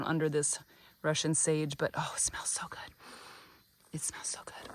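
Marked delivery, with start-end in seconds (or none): 1.9-4.7 a soft, fading whisper of bliss; whispered delight. Real, not acted. Gardener savouring the rosemary's scent, whispering. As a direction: breathe it out as you savour the smell. Smelling rosemary under the sage.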